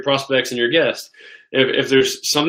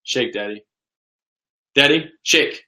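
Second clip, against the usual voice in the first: waking daughter's request. Retold or imitated. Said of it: "opens one eye. She goes".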